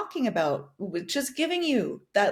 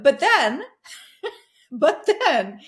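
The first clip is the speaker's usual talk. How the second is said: laughing; 5 semitones higher, 7 dB louder, slower than usual. Real, not acted. Laughing at her own stubbed-toe story.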